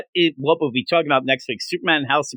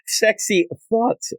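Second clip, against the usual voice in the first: sexy thoughts drawn out slowly and higher; slow teasing drawl; 6 semitones higher, much slower than usual. Shown as a demonstration. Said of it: "a thing for later".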